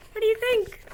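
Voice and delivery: plain reading voice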